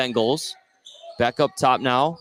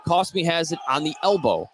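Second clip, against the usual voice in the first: ordinary delivery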